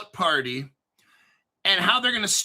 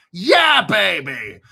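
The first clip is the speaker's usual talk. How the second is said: excited cheer; 8 dB louder, slower than usual. Real, not acted. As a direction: celebrate it like a win. Celebrating the news.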